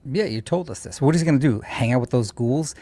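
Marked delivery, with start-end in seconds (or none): none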